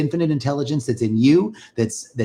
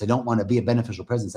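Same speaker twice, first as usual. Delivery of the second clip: ordinary talk, nothing marked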